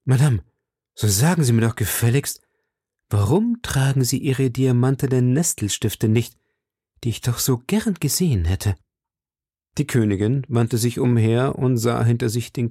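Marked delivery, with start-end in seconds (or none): 0.1-8.8 moved voice. A narrator's reading. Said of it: "mit bewegter Stimme".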